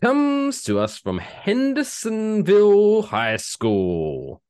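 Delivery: high, slow, stretched-out fanfare; hammed-up announcer. Host unveiling the feel-good story, hammed-up announcer. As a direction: draw every word out grandly.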